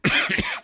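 loud rough cough mid-word; chesty cough. Real, not acted. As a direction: cough hard and carry on. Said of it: "mi continuo a scusare per la tosse".

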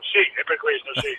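speakerphone while driving; distant phone voice. Real, not acted. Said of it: "per questo parli in viva voce".

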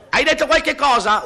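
high-pitched yell over engine noise; comic shouted question; acted shout. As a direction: call it out to someone who can't hear. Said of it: "urla lui".

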